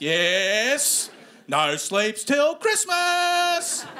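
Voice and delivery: loud, high, drawn-out squeal; mock manic excitement. Comic as mate on meth before Christmas, manic excitement. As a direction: build to an absurdly overexcited cry. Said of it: "then you get excited about anything".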